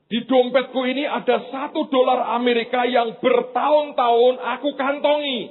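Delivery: high, earnest voice for the visiting pastor; voicing the American pastor. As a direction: lift the voice up to play Pastor Gary confiding. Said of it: "Dia berkata begini". (suara pendeta tamu yang tinggi dan sungguh-sungguh)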